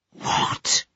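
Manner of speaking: a low, breathless burst of shock; shocked low gasp. A narrator's reading. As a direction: whisper the word out, stunned. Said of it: "low-voiced explosion of shock".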